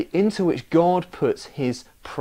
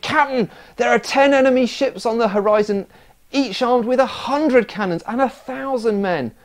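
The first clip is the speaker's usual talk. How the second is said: 1 alarmed shout; 8 semitones higher, 4 dB louder than usual. Acted. First mate shouting a warning.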